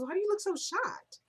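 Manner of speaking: high, mock-innocent needling; taunting. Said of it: "She's taunting her the entire time".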